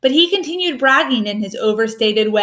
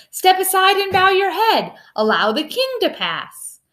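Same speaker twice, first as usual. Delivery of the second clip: calling out; 7 semitones higher than usual. A narrator's reading. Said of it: "then called out to the".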